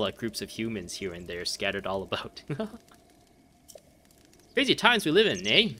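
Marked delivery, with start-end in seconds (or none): 4.5-5.7 a light Canadian lilt, higher and louder, leaning on the eh; Canadian accent. Retold or imitated. Gamer voicing the traveling merchant in a light Canadian accent. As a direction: read the merchant's line like a cheerful Canadian. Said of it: "oh, you're Canadian".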